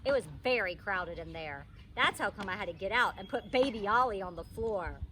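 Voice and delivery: plain reading voice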